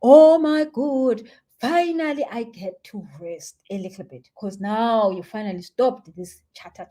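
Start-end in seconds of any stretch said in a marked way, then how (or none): 0.0-4.3 drawn-out, loud sigh of relief easing off; big slow "oh my God", then settling down; exhausted brain. Acted. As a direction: play a worn-out brain finally getting rest. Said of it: "your brain is exhausted".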